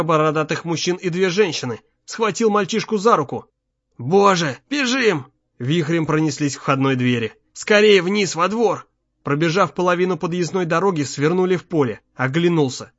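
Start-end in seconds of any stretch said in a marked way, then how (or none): 4.0-5.3 urgent cry leaping higher; panicked father raising his pitch; alarmed cry. A narrator's reading. Father sees captives, urges flight. 7.5-8.9 higher, forceful urging; father hurrying his son, voice raised; urgent command. A narrator's reading. Father urges son to flee.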